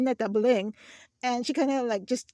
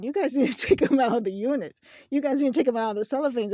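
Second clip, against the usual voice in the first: louder, half-laughing exasperated gripe; a louder, indignant rant with a laugh; exasperated complaint; 2 semitones higher, 6 dB louder, faster than usual. Retold or imitated. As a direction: vent at the store staff, laughing through it. Exasperated inner complaint about the store.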